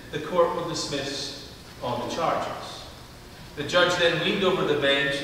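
normal talking voice

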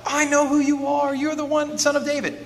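high, sustained mock cry. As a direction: call out on one high pitch, imitating the crowd. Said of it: "people will cry out".

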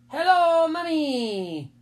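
high, loud singsong greeting; drawn-out, childlike hello; little boy voice. A narrator's reading. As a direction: greet Mummy like a small child. Little brother greets his mother.

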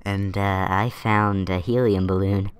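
squeaky voice from balloon helium. Real, not acted. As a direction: talk after sucking helium from a balloon. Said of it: "that's why my voice is sounding funny".

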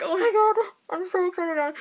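high, breathless giddy gush; giddy excitement. Retold or imitated. Vlogger recalling meeting Gabby, high giddy gushing. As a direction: gush like a starstruck fan. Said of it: "but I'm like".

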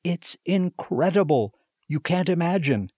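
too happy to stand; overjoyed. A narrator's reading. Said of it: "so overcome by happiness".

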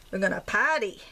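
playful thick Maine accent; down-home Maine accent. Shown as a demonstration. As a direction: lay on the Maine accent with dropped Rs. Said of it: "I'm from Maine, in case you can't tell".

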